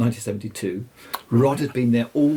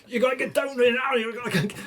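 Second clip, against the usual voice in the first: high, urgent, frantic; frantic urging; 13 semitones higher, faster than usual. Retold or imitated. Rod phoning his friend to come now, high and frantic. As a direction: bark it down the phone, desperate to hurry him. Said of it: "he was incoherent".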